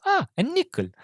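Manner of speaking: tiny high delighted character voice; Enrico. Retold or imitated. Passenger finding a coin while searching, high innocent voice. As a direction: mimic an innocent's small squeal of discovery. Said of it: "And he goes".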